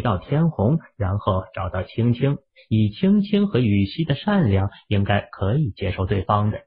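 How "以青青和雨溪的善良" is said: ordinary delivery, nothing marked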